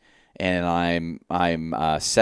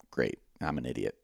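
low self-disgust; 7 semitones lower, 12 dB quieter than usual. Retold or imitated. Retold thought after missing the bull.

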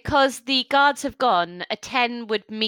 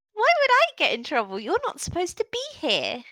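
put-on high, pouting little girl with arms crossed; a child threatening to tell her mum; stubborn orc child; 6 semitones higher, faster than usual. Orc child crossing her arms at the adventurers, high and pouting.

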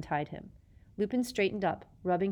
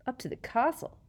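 silky; 8 semitones higher than usual. A narrator's reading. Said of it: "said Snape silkily".

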